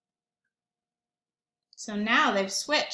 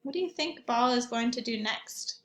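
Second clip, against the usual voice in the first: ordinary delivery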